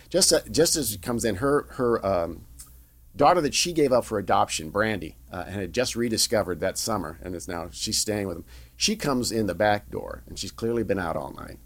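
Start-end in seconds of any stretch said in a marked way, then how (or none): none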